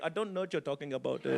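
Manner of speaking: low, quiet imitated Indian accent. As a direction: play the Indian dude denying it, softly. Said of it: "it was an Indian dude".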